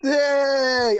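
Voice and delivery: loud, high whoop of delight; a real shout of joy; excited cheer. Real, not acted. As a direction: cheer as you come on the show. Guest greeted on the chat show.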